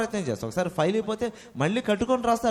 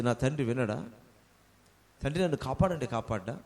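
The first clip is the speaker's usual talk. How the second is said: softly; 6 semitones lower, 7 dB quieter than usual. Retold or imitated. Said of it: "మెల్లగా చెప్తే".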